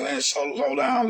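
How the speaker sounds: retold exasperated plea. Retells pleading with a fast speaker.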